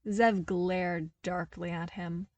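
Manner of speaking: ordinary delivery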